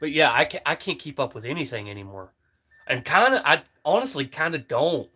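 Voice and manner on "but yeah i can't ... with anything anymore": ordinary delivery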